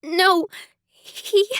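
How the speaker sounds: breaking up, on the edge of tears; a cracked, tearful start; voice cracking. Acted. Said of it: "my voice cracked as tears".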